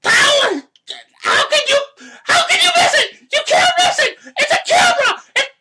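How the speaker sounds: high, loud, cracking outrage; raging rant. Real, not acted. Host venting at the crowd in front of his camera, furious. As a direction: let the rage burst out. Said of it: "I've been wanting to rage out about that".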